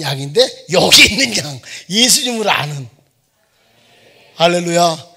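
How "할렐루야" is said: 할렐루야 said louder and firm; firm hallelujah. Acted. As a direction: proclaim 할렐루야 with confidence. (할렐루야를 힘차게)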